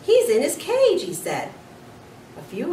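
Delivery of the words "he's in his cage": very high, loud blurt; boy blurting in disbelief; blurted alarm. A narrator's reading. Garth blurting out.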